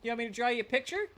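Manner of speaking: high, loud teasing voice; mock smug chatter. Chatter offering to paint the streamer, high and mocking. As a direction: tease like a cocky admirer. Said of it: "I'm like".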